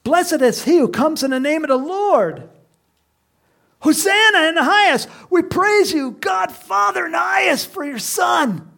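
high, loud shouts of praise; imitated crowd yell. Preacher voicing the crowd praising God, high exultant shouting. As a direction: belt out praise like a wild crowd. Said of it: "they're yelling out".

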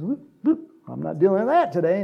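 high whoop, then fast brush-off; hammed-up high whoop and hurried excuse; dismissive priest. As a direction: voice the priest dodging the problem quickly. Priest acted brushing off victim.

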